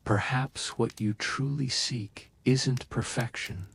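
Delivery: plain reading voice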